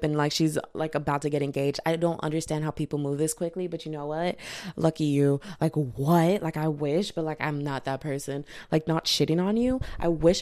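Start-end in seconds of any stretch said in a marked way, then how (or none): none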